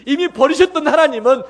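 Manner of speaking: loud, high, impassioned preaching; preaching at full force. Acted. Preacher proclaiming the cross to his congregation, loud and impassioned. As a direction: proclaim it to the whole church.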